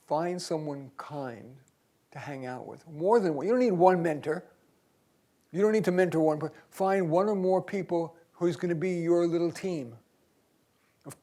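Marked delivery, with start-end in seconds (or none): none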